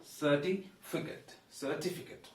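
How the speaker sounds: 'Certificate' broken up, then whole, ending 'kut'; model pronunciation. Shown as a demonstration. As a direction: say 'Certificate' with a short last 'fi-kit'. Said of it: "goes like this".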